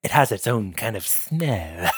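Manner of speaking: low, soft, mock-refined vampire voice; hushed smug Edward. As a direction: purr it like a proud wine taster. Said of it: "opens it up and goes".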